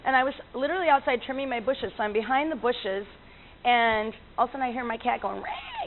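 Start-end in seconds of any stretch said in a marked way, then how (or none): 5.4-5.8 very high, soft cat yowl; thin mock yowl, held; imitated cat cry. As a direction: squeal like a protesting cat. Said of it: "making this noise".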